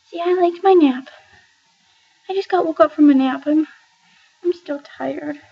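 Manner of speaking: high, put-on sleepy cat voice; voicing the cat. Owner voices her woken-up cat, high sleepy pet voice. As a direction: speak for the cat who was woken from her nap.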